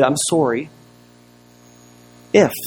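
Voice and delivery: long pause before IF; hesitant apology. Retold or imitated. Man giving a grudging apology after a deep breath, pausing before IF. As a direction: hold back the IF after "I'm sorry". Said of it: "he took a deep breath".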